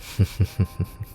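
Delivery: breathy little chuckle. Acted. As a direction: laugh under your breath, amused. Laughs while teasing detective.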